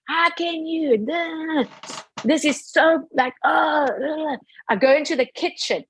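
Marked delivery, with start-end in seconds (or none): 0.1-4.4 high-pitched, exasperated imitation of screaming; mock scream. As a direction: act out a furious meltdown without full volume. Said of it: "Now I'm really screaming, shouting, top of my voice".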